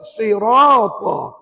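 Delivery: SIRATA slowly with heavy r and long vowel; thick ra. Shown as a demonstration. Teacher asks students to repeat, slow SIRATA with heavy r. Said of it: "Ada tujuh ra tebal".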